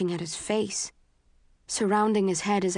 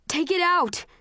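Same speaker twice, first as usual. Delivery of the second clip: high, loud desperate yell; 11 semitones higher, 4 dB louder than usual. A narrator's reading. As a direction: yell it in panic. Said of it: "he yells".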